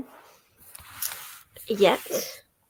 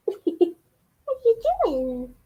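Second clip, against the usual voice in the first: high squeaky voice to the cat; pet voice; 8 semitones higher, faster than usual. Real, not acted. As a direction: coo at the pet in a much higher voice.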